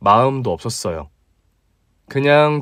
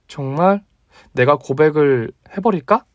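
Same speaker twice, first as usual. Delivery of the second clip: female friend voice; 3 semitones higher than usual. Acted. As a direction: play the bold female doctor, pitched up. Female colleague confesses. (높은 톤의 당찬 여자 목소리)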